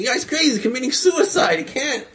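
high-pitched, incredulous bystander; retold outcry of disbelief; alarmed crowd. Crowd reacts to a deadly stunt.

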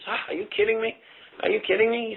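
high-pitched, mock-incredulous comeback; incredulous retort. Banker answering whether he helped his country, retold as incredulous.

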